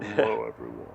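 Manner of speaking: lightly done deep crooner voice; a hint of a smooth soul singer.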